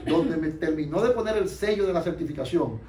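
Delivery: usual speaking voice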